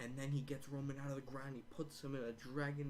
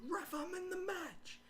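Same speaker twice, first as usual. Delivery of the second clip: very high, slow mock holler; imitated wrestler's call; slower than usual. Paul Heyman telling the ref, high hammed-up call. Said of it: "He's like".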